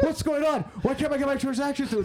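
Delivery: high-pitched mock frantic yell; screaming in panic. Investor imitating panicked people in the Telegram channel, mock screaming. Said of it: "They're screaming. They're like".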